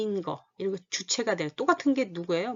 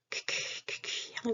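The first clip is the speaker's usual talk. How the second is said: musty whisper; 10 dB quieter, slower than usual. Shown as a demonstration. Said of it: "후각이겠네요".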